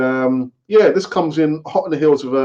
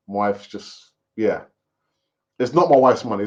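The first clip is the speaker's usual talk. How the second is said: ordinary delivery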